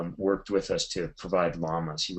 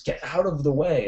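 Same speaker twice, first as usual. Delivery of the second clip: higher, louder, insistent warning; adamant retold shout; 9 semitones higher, 5 dB louder than usual. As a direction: act out an adamant repeated warning. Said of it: "adamantly says".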